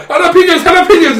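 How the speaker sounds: high yelled jalapenos; hammed-up frantic shout; mock panicked cry. Retelling family yelling at the picnic, high mock panic.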